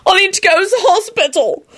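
high-pitched pained wail; hammed-up whimpering howl. A narrator's reading. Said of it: "Ron howled".

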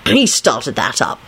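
low, slow defence; self-righteous. A narrator's reading. Lupin blaming Dragomir, self-righteous. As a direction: shift blame slowly, righteously. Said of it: "replied self-righteously".

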